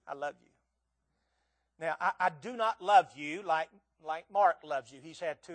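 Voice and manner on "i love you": softer tender. Real, not acted. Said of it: "just to say it like this".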